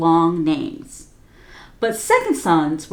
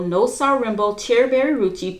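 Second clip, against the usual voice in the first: usual speaking voice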